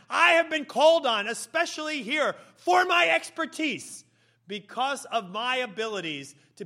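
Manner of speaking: high, loud, grandly self-important proclamation; pompous mason showing off to a knight; boastful craftsman. Acted. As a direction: puff yourself up and proclaim your own greatness. Eager second worker boasts to knight.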